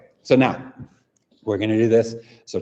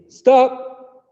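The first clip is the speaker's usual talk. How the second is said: shouted stop, much higher and louder; 7 dB louder than usual. Shown as a demonstration. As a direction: shout it across the room.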